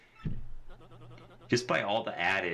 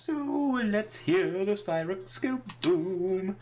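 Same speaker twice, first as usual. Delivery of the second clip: high, drawn-out grand incantation; showy, high-pitched chanted rhyme; theatrical verse recital; 12 semitones higher, slower than usual. Acted. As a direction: recite it slowly like a pirate riddle, pitched up.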